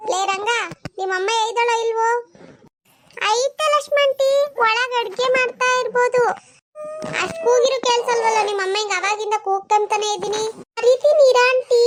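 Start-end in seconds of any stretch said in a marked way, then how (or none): 3.3-6.2 put-on high boyish voice, eager; a boy telling the aunty his mother is inside; young boy. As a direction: play the little son answering the door. Boy answers the visiting aunty.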